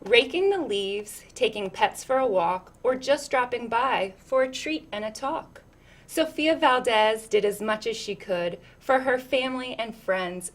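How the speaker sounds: lilting, rhythmic read-aloud; picture book reading. Acted. Reading a picture book to children, lilting storyteller voice.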